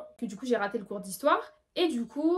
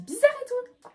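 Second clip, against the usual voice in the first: puzzled lady; 12 semitones higher, 4 dB louder than usual. Retold or imitated. Lady's puzzled thought.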